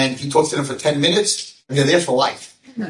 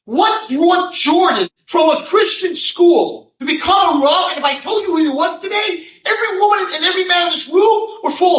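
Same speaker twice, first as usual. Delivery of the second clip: preaching at full force; 8 dB louder than usual. Real, not acted. Preacher rousing the ladies and men, full-force shout. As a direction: thunder it to the hall.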